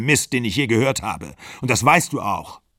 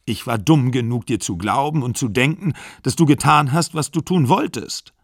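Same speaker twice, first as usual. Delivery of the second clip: higher, louder, pleading rage; anguished angry plea; 6 semitones higher, 4 dB louder than usual. A narrator's reading. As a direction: beg her to stay, furious. Exler pleads and rages.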